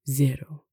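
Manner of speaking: "zero" with a buzzing English Z. Shown as a demonstration. Teacher gives an example of S between vowels, English Z. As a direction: voice the first sound of "zero" as a plain Z. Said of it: "pronounced like the English Z".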